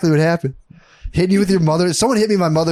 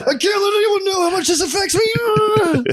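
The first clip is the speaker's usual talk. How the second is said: much higher, slow, strained mock anguish; mock tortured soul; slower than usual. To his cohost, mocking grown men hiding feelings, in a hammed-up high anguished voice. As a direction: ham up a man hiding his pain.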